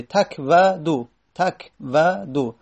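slow careful repetition. Shown as a demonstration. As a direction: say تک و دو slowly, each sound separate. Teacher slowly articulates word twice.

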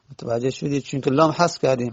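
usual speaking voice

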